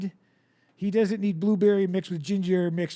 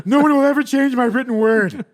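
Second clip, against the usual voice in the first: defiant proclamation; 7 semitones higher, 9 dB louder than usual. Pretend. Twain voiced boasting defiantly.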